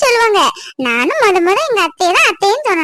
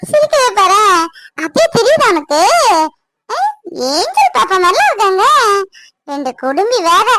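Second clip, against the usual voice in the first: put-on baby talk; 3 semitones higher than usual. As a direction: coo at the little one like she's the whole world. Cooing to the baby.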